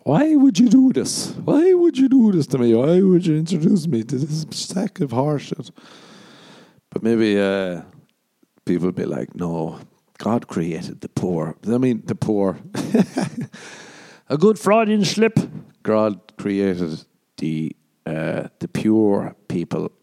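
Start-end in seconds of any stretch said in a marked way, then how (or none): 0.1-5.7 high anguished wail. Acted. Anguished cry to God. 9.3-11.6 very slow preachy declaration; slow solemn pronouncement. Acted. 12.6-13.7 squeaky giggle; high breathy laugh. Real, not acted. Laughs at slip. 15.8-19.9 very slow preachy declaration; slow solemn pronouncement. Acted.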